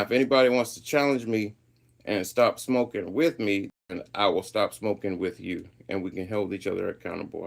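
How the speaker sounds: usual speaking voice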